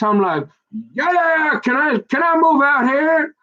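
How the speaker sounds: high, eager hype; hyping up the trip, voice way up; excited. Real, not acted. Begging Daddy to move out here, high and eager.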